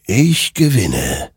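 slow, ominous closing words; lightly voiced creature, unhurried; unearthly rider. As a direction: stretch the words out with finality. Creature answers slowly.

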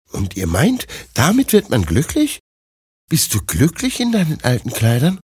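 high, questioning princess voice; doubting princess. Acted. Princess doubts old woman's happiness, high questioning voice. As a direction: challenge it as a sceptical young princess.

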